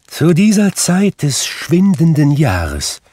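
high, loud spectral lament; lamenting ghost. A narrator's reading. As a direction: declaim it as a suffering spirit. Narrator voices Marley's ghost.

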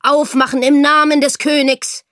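a loud, commanding shout at the door; pounding demand. A narrator's reading. Soldier at the door demanding entry, loud and commanding. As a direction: bang out the order.

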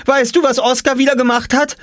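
high, loud eager call; high child's call across the yard. Acted. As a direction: call out to Papa to tell on Oskar. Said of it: "rief Jule noch".

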